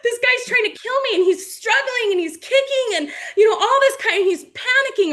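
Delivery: high, loud panicked voice half laughing; mock panic. Coach acting out a drowning young man, laughing panic. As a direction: ham up a drowning kid's terror through laughter. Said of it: "the young man is like".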